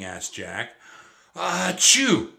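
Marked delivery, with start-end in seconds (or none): 1.4-2.3 big acted sneeze, high and loud; explosive hammed-up achoo. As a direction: let the sneeze burst out.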